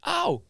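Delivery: high-pitched acted "ow"; mock pain yelp. Shocked man answering twenty volts, mock pain yelp. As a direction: imitate someone jolted by a shock, very high.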